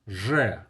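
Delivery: "Же" said loud as a buzzing "zhe"; Russian letter demo. Shown as a demonstration. Tutor models the Russian letter for learners. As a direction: sound out "Же" for the class. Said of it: "The next is же".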